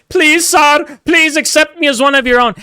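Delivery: high, begging voice of a groveling immigrant; mock pleading. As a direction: plead like a servant begging for acceptance.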